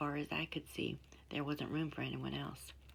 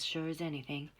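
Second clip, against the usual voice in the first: ordinary delivery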